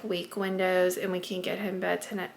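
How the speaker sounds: usual speaking voice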